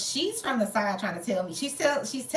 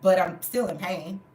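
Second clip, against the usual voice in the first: ordinary talk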